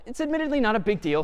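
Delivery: normal talking voice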